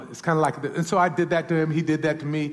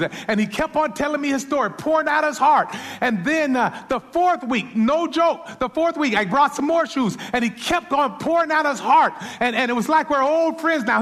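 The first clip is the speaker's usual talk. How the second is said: higher, louder, driving sermon delivery; preaching at full force; 8 semitones higher, 5 dB louder than usual. Acted. Pastor telling his congregation the story, full force preaching.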